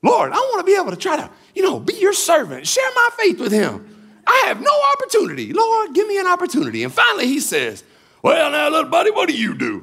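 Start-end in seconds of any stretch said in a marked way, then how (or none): 0.0-6.8 high, fast, pleading; rushed desperate inner prayer. Retold or imitated. Desperate silent prayer retold. 8.2-9.8 hearty trucker. Retold or imitated.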